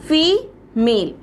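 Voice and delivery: Female slowly split in two, a long pause between the syllables; two separate, drawn-out beats in female; broken into syllables. Shown as a demonstration. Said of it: "आप इसकी spelling को दो बार तोड़ सकते हो".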